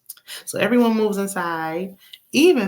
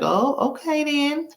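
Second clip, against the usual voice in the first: high, slow and airy, brushing it off; mock dismissive reply; 8 semitones higher than usual. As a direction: play the comeback that isn't one, pitched up and dragged out. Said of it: "She was like".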